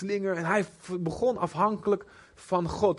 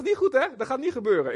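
much higher, teasing warning; mock rebuke; 7 semitones higher than usual. Jesus checking an overconfident Peter, teasing warning. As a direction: wag a finger at him.

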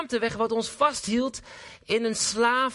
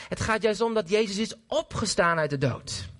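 ordinary delivery

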